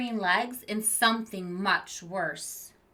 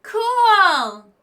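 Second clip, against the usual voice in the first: loud, high delighted cheer; excited kid; 12 semitones higher, 17 dB louder than usual. Acted. A student cheering the creature's tale, loud and excited. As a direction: shout it like a thrilled kid. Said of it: "said Luke".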